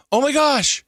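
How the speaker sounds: high, loud excited outburst; emotional exclamation. Retold or imitated. Host re-enacting reaction to uncle news, excited exclamation. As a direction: burst out high, re-enacting thrilled surprise. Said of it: "I was getting a bit emotional".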